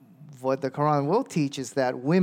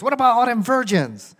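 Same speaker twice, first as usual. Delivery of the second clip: a much higher, louder challenge about the virgins; indignant church lady; 10 semitones higher, 7 dB louder than usual. Retold or imitated. Church lady calls out the preacher, high and loud. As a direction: push the question at him, voice jumping up. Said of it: "And she goes".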